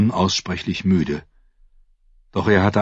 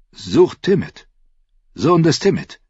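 higher, lightly voiced dwarf lord; Tyrion's voice; 10 semitones higher, 4 dB louder than usual. Tyrion answers the Hound about the horse, higher voice. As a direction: pitch up into Tyrion's quick voice.

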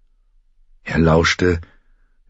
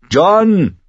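high, loud drawn-out call; urgent high call; calling after him; 5 dB louder than usual. A narrator's reading. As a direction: shout the name after someone riding off. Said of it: "rief Pip ihm nach".

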